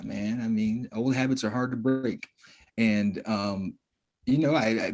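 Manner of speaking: ordinary delivery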